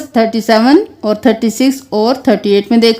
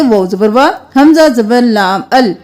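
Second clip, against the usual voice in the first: ordinary talk, nothing marked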